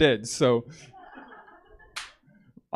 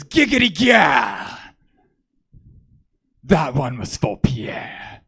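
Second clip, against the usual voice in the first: drawn-out shouted cackly catchphrase, then slow vow; grandpa impression; 6 dB louder, much slower than usual. Grandpa after shooting the dog, mock triumphant old-man shout. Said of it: "then he says to me".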